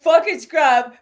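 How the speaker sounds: loud, high mock curse; exaggerated catchphrase imitation; Steve impression. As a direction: punch it out high and loud, mimicking. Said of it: "that's a good Steve impression".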